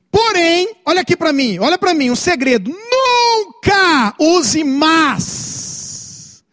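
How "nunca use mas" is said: "Nunca use mas" drawn out very slowly and shouted, higher; stress on Nunca. Real, not acted. Pastor stresses key secret.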